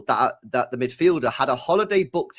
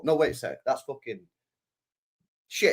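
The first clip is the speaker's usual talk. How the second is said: normal talking voice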